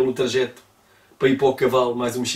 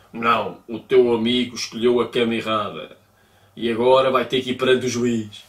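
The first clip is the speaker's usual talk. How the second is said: a lightly gruff sheriff voice, a bit lower; 4 semitones lower than usual. Retold or imitated. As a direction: growl the refusal like a tough old lawman, lightly imitated. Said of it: "com uma voz muito, assim, tipo, quase meio matarroeira".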